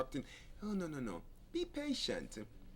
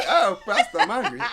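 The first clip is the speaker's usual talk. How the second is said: laughing, much higher and louder; mock angry; 7 semitones higher, 17 dB louder than usual. Pastor mimics an angry wife, laughing loudly. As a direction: play the upset wife and crack up. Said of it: "you're angry and you're".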